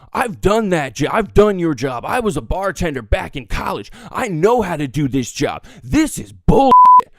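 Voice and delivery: indignant, building to a loud outburst; acted angry rant. Customer to bartender about doing that job, angry. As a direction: pull rank, then erupt on the last words.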